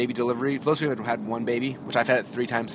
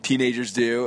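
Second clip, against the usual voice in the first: ordinary talk, nothing marked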